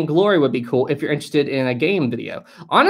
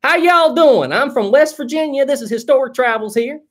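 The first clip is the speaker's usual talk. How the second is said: exaggerated West Virginia twang, pitched much higher and louder; hammed-up West Virginia accent; 10 semitones higher, 3 dB louder than usual. Shown as a demonstration. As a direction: lay the West Virginia accent on thick to show off. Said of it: "I always turn it up a notch".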